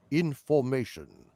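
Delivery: ominous, unhurried British villain; British accent. Acted. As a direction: draw it out in a measured British voice. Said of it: "like in the opening of The Prisoner".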